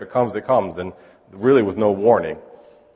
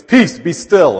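commanding; 6 semitones higher, 7 dB louder, much slower than usual. Pretend. Jesus commands wind and waves.

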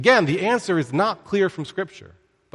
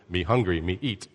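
low, grunting caveman talk; dumb brute voice; 7 semitones lower, 6 dB quieter than usual. Retold or imitated. Teacher mocking Samson as a brute, low caveman voice. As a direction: boil him down to a dim caveman.

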